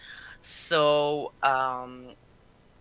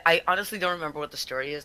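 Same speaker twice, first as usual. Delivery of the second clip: ordinary talk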